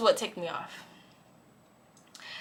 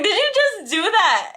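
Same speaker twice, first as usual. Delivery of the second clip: high, loud incredulous question; shocked disbelief; 11 semitones higher, 6 dB louder than usual. Retold or imitated. Best friend reacting to the kicking, shocked. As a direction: ask in scandalized astonishment.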